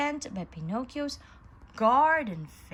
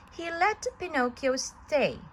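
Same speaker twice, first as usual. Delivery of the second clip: ordinary delivery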